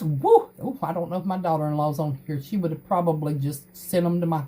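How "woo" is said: loud little whoop of joy; louder happy whoop; cheer. Real, not acted. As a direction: celebrate the news out loud. Cheering the triplets.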